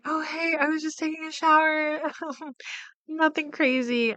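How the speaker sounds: high, soft, innocent cover-up voice; fake casual. [name] covering up after Wendy shows up, fake casual.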